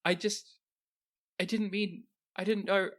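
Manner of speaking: high, frightened stammering apologies; cornered cultist apologizing in panic; panicked apology. Acted. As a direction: blurt sorry in a high panicky voice. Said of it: "with panic in her eyes".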